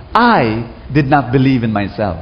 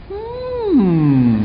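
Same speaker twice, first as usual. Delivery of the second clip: high musing hmm; 9 semitones higher than usual. Retold or imitated. Teacher's reaction retold.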